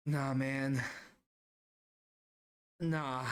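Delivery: drawn-out, dumbstruck reply with long pauses; stunned refusal. Retold or imitated. Salesman reacting to naked customer, stunned. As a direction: let it out slowly, at a loss.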